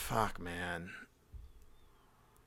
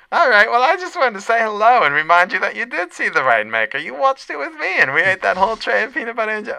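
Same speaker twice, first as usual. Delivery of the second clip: very high, louder doting lunch lady; 11 semitones higher, 6 dB louder, faster than usual. Pretend. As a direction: coo at him like an adoring cafeteria lady. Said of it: "Squeezer's Lunch Lady live".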